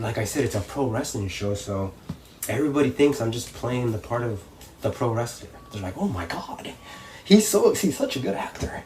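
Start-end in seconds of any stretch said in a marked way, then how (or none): 6.0-8.8 imitated awed fan, climbing high; mock amazed spectator. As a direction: play an impressed onlooker, lightly hammed-up. Voices impressed audience.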